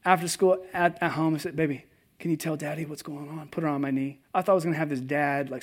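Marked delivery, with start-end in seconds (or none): 1.6-3.5 put-on soft, coaxing to a child; quiet tender father asking his daughter; gentle dad. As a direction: soothe her open gently.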